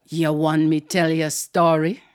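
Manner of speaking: put-on low, measured aunt with an island accent; Aunt Queenie. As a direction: offer it in a deep island-aunt voice. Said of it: "she had a deep, deep voice".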